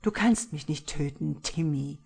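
usual speaking voice

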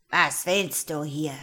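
loud, harsh challenge; menacing sorcerer demanding answers; wizard's shout. A narrator's reading. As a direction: roar at a small intruder. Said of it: "schrie er das arme Englein an".

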